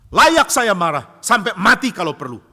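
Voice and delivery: loud, high, defiant outburst; acted furious retort, first words drawn out; angry. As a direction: insist on your right to rage. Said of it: "jadi Yunus marah".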